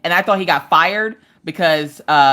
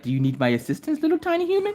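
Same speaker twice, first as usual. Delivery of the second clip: Daisy; 9 semitones higher, 6 dB quieter, faster than usual. Retold or imitated. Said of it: "Daisy's like".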